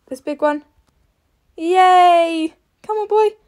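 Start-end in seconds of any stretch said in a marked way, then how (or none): 1.6-2.5 loud, high cheer of delight. Real, not acted. Rider cheering her horse over the big jump, loud and high. 2.8-3.3 much higher, eager call; excited urging. Real, not acted. Rider urging horse at the jumps, high and eager.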